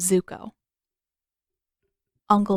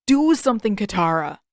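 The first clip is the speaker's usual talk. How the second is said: loud, urgent call for help; urgent plea; 4 dB louder than usual. A narrator's reading. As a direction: demand she act now. Sokka pleading while caught.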